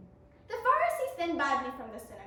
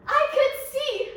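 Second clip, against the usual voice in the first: laughing; 8 semitones higher, 10 dB louder, slower than usual. Acted. Retelling the healing, laughing.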